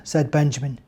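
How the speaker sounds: plain reading voice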